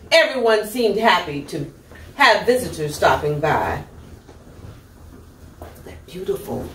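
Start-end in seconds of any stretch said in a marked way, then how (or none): 5.8-6.7 lower, softer admiring aside; hushed admiration. Real, not acted. Reader admiring the peacock picture with children, hushed. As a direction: marvel quietly at the feathers. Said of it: "admiring aside to children".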